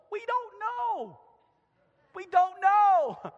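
high, drawn-out cry; exclaimed rebuttal. Acted. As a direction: call it out slowly, high over the crowd. Calls out against the excuse.

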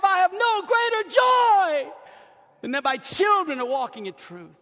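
loud, high, impassioned preaching; preaching at full force. Real, not acted. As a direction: build to a shout of conviction.

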